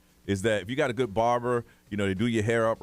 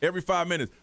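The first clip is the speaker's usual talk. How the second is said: ordinary talk, nothing marked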